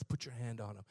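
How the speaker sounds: low, soft calm instruction; quietly instruct, low and unhurried. Retold or imitated. Old man guiding him during service, calm and soft. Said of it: "He, calmly".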